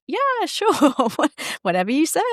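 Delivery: high, laughing, brushing it off; mock a client who just gives in; half-laughing yeah sure, whatever you say; dismissive mock agreement. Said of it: "rather than feeling like".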